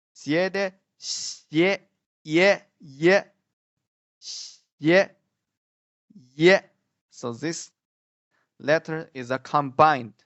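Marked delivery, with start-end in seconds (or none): 0.1-0.7 "Siete" run together quickly, higher pitched; quick and firm. Shown as a demonstration. Teacher linking Spanish to Chinese ye, "Siete" quick and all together. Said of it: "Read it quickly and firmly and all together". 1.0-6.6 "xie" and "ye" as short, high single syllables; firm and together. Shown as a demonstration. Teacher drilling the Chinese final for learners, "ye" firm and joined. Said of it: "Read it quickly and firmly and all together".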